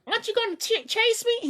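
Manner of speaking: very high put-on character voice; squeaky-high mock question. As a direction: mimic a cartoon character in high falsetto.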